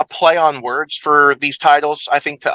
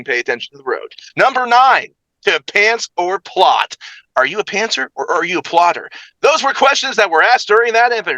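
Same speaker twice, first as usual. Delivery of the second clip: game-show style host with high, punched countdown calls; big showy countdown host voice; hyped recap announcer; 5 semitones higher than usual. Acted. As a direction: belt out each number, then ease into the comments. Hosts recap as Ralph McGee.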